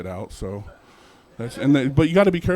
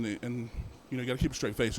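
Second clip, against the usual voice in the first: ordinary talk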